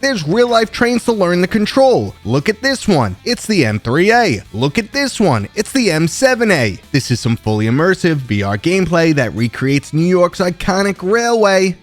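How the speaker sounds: put-on loud brash New York accent. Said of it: "I'm gonna ham up my New York accent".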